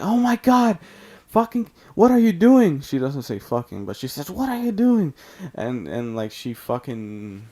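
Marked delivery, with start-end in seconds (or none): 0.0-2.8 high, loud mock shriek; shocked teacher. Horrified teacher walks in, acted. 4.3-5.1 high-pitched, loud mock outcry; imitated scandalized cry, pitched way up; shocked teacher. Teacher's cry acted out again.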